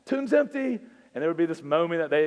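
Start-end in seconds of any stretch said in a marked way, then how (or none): 0.0-0.8 much higher and louder playful greeting; cheery call. Retold or imitated. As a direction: call it across the quad like an inside joke. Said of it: "he'd look at me and say".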